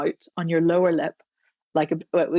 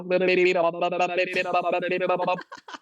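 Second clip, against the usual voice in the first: finger-on-lips hum glide; lip buzz through finger. Shown as a demonstration. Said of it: "And then you're gonna go".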